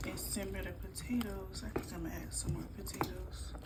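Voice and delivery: whispered aside to herself; quick soft whisper. Real, not acted. Whispered aside while cooking.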